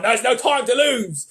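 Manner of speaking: "There's no time to lose!" in a loud, slowed Cockney accent. Shown as a demonstration. Mister Beaver urging the children in a loud Cockney accent. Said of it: "Cockney rhyming".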